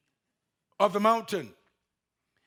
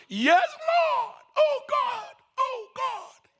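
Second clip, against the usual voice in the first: much higher, shouted cries of relief; desperate relief; much slower than usual. Acted. Climber shouts to God, high and relieved. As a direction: cry out in desperate joy.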